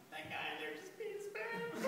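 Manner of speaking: high, squeaky words through laughter; soft, high giggling gasp; helpless laughter. Acted. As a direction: barely get it out for laughing. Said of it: "I'm laughing so hard".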